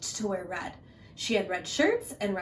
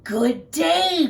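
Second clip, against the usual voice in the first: growling wolf; 7 semitones higher, 7 dB louder than usual. Acted. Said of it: "growled the wolf".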